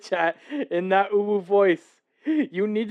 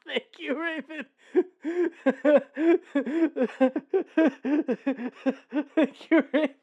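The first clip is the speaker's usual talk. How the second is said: squeaky high laughing thanks; 10 semitones higher than usual. Real, not acted. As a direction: giggle through the thanks. Laughing fit after clip.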